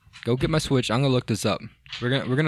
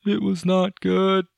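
higher, louder, slow raspy Clinton impression; Bill Clinton voice; 8 semitones higher, 4 dB louder, slower than usual. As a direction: play a slow, pleading ex-president. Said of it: "that was my Bill Clinton voice".

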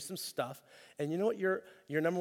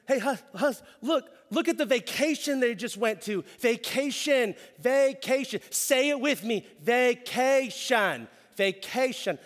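high, loud nagging wife, drawing out vacation; wife begging husband, stretching vacation; envious wife; 8 semitones higher, 7 dB louder than usual. Acted. Wife nags husband about vacation.

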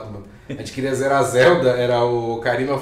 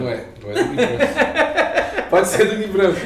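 high-pitched, laughing through the words; 7 semitones higher, 4 dB louder than usual. Real, not acted. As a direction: crack up while denying it, then giving in. Teased, cracking up while answering.

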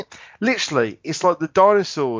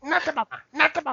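put-on baby Dinosaurs catchphrase; 8 semitones higher, faster than usual. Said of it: "repeating catchphrase".

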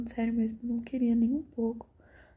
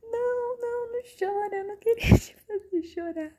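high, squeaky pleading friend; imitating friend; 9 semitones higher than usual. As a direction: pitch way up, beg her not to cry.

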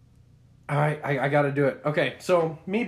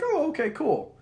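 high, offhand "okay, cool"; casual pitched-up reply; 13 semitones higher than usual. Retold or imitated. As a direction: toss it off lightly, pitched up. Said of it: "I was like".

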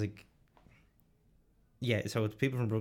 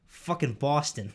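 put-on drawn-out, louder Boston accent; Boston Irish accent; 4 semitones higher, 5 dB louder, slower than usual. Boston Irishman answering where he's from, Boston accent. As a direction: stretch it in broad Boston. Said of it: "the Boston Irish one's funny as well".

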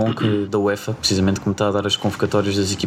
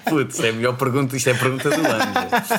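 after laughing hard; 4 semitones higher than usual. Real, not acted. Still laughing at the joke.

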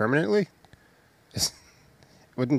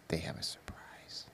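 hushed teasing whisper; 22 dB quieter than usual. Real, not acted. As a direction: breathe it like a secret.

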